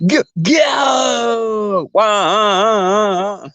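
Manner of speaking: high, long drawn-out yell; game-show catchphrase shout. Acted. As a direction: stretch it into a high mock wail. Imitates quiz host's catchphrase shout.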